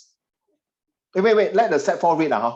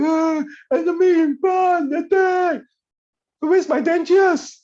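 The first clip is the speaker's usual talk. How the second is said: put-on much higher, slow old-man squeak, shouting attack; a feeble grandpa panicking in battle; mock old grandfather soldier; doddering grandpa; 14 semitones higher, 4 dB louder, slower than usual.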